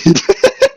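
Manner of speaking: a sharp, high burst of real laughter; cracking up at the memory; loud high laugh. Real, not acted. Laughing at stranger's reaction.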